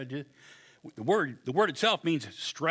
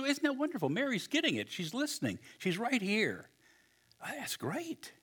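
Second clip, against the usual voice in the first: delighted, tender; 8 semitones higher, 6 dB quieter than usual. Retold or imitated. Jesus voiced delighted.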